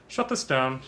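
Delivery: stern bureaucrat ordering a shutdown; curt official voice; Walter Peck impression. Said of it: "looking a little like Walter Peck".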